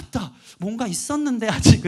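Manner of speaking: put-on child's startled gasp of memory; startled remembering. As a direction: blurt it like a kid who just remembered.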